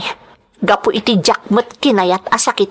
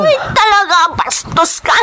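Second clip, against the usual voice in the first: higher, hissed contempt; angry insult; 7 semitones higher than usual. Acted. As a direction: spit the insult at him. Insults the driver.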